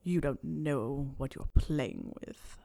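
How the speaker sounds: low, slow growl; deep, drawn-out menace; Snape. A narrator's reading. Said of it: "Snape growled".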